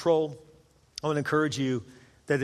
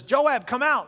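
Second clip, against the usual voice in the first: high, raised summons from outside; calling out; 6 semitones higher, 4 dB louder, slower than usual. Retold or imitated. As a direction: call someone out of a building. Benaiah calls into the temple.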